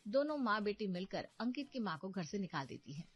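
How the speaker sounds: plain reading voice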